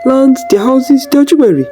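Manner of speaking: a lightly voiced son eagerly tempting his mother; Jaga's voice. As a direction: pitch the idea with growing eagerness. Jaga proposes a scheme.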